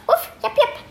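high yips breaking into a giggle; laughing puppy yips. Pretend. Child voicing the book's dogs, giggly high yips. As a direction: yip high while giggling. Dog noises in picture book.

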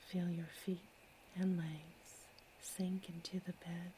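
ordinary delivery

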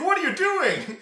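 high, loud shocked cry; a horrified yell at the shooter; shocked shriek. Retold or imitated. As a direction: shriek it in disbelief.

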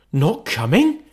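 a touch of sudden disappointment, rising question; father's spirits sinking; crestfallen. Acted. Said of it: "sudden declension in his high spirits".

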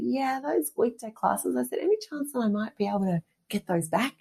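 butter up the boss with a high hopeful voice; sweet asking voice. Retold or imitated. To her fitness manager, asking for her classes back, high and sweetly hopeful.

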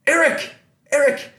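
high, slowed hail; hopeful mock shout for someone's attention; retold calling out. Fan hails keyboardist for an autograph, retold calling out.

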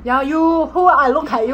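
loud, rude Hong Kong English; mock-confrontational Hong Kong accent; overdone Hong Kong accent. Shown as a demonstration. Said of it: "やりすぎじゃない".

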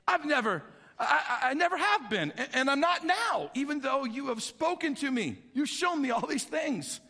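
acted anxious excuse-making, pitch climbing; flustered pleading. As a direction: plead insistently, tripping over the first words. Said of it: "Moses pleaded with the Lord".

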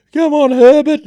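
hammed-up handler begging the bird; pleading with the bird.

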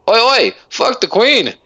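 high-pitched Irish heckle; Irish peasant. Acted. Irish peasant heckling the royals at the fair, Irish accent. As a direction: call out as a rowdy Irish peasant. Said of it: "I was a Irish, uh, peasant".

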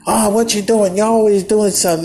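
high-pitched, imitated frenzy of scolding; mock a mother flying into a frenzy; frantic mom. Mom scolding her son for switching water companies, in a frenzy. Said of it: "my mom goes into this frenzy".

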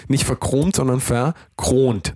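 slow, with verchromt and verkront both stressed. Shown as a demonstration. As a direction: draw out verchromt, then land on verkront. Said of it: "jetzt lass mich doch mal einmal ausreden".

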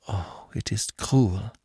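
soft, slow choked-back lament; stifled cry. Acted. Aline grieving his departure, stifled and soft. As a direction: hold the tears in, barely voiced. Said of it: "cried Aline in a stifled voice".

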